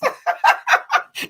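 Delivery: breathy high-pitched giggle; high laugh. Real, not acted. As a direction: squeal with delighted laughter. Shared laughter at coincidence.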